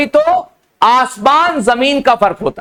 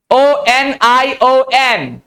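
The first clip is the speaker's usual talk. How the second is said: letters called out one by one, slowly; slow spelling; much slower than usual. Shown as a demonstration. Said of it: "ओ को अक्सर जगह आ पढ़ते हैं". (धीरे धीरे अक्षर बोलते हुए)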